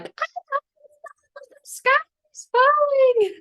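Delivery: high, wailing whine with a sob; tearful Chicken Little. Retold or imitated. Chicken Little insisting to the doubting animals, high teary wail. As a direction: whimper it like a frightened chick. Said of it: "And Chicken Little is like".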